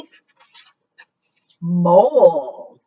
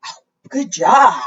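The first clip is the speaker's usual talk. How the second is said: ordinary delivery, nothing marked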